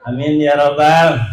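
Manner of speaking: drawn-out chanted greeting and praise; sermon opening. Acted. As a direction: open the sermon with melodic calls to the crowd.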